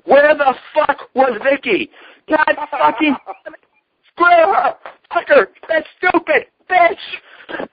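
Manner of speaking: high-pitched mock outburst, cursing Vicky; imitated yelling. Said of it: "hear him yelling".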